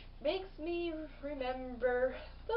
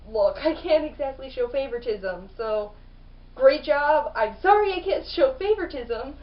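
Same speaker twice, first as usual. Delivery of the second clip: a louder, stiff, apologetic king of the gods; lightly voiced Zeus; 8 dB louder than usual. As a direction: announce it like an awkward official father. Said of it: "Zeus pretty much being like".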